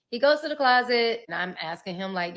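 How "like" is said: normal talking voice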